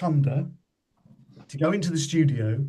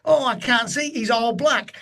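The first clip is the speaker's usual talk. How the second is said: lightly voiced, higher muffled complaint; helmeted man; 8 semitones higher than usual. The man stuck in the crash helmet answers Arkwright, higher voice. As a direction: whine it a touch higher, as if from inside a helmet. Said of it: "he goes".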